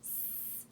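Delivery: soft, voiceless snake hiss. Pretend. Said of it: "goes the snake".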